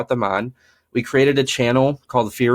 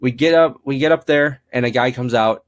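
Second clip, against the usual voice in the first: ordinary delivery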